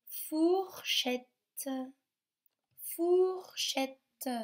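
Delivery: "fourchette" high and slow, four then chette; careful sound demonstration. Shown as a demonstration. Teacher asks children to listen for ou, high, slow "fourchette". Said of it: "Vous entendez ou vous entendez pas le ou".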